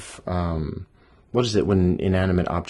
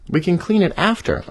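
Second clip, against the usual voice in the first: high pleading bunny; 11 semitones higher, 3 dB louder than usual. Acted.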